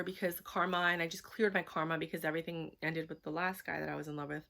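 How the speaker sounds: ordinary talk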